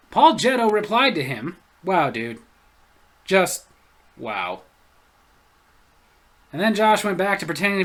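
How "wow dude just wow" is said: low, unimpressed drawl; deadpan. Retold or imitated. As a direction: sound thoroughly unimpressed. Reading a deadpan reply.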